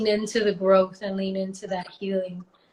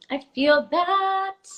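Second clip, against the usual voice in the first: drawn-out emphatic; 8 semitones higher, 4 dB louder, much slower than usual. Real, not acted. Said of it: "repeats, drawn out higher".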